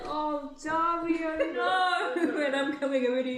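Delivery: high, swelling motherly dismay; dismayed sympathy. Acted. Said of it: "she's like".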